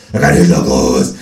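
proud bigot. Acted. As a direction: boast like a dim dog owner. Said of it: "sagt Herr Freundlich".

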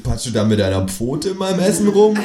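affronted exclamation; calling out in protest. A narrator's reading. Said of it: "rufe ich".

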